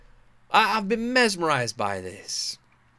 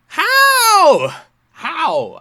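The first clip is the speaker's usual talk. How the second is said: excited disbelief; 10 semitones higher, 8 dB louder than usual. Real, not acted. Amazed reaction to video.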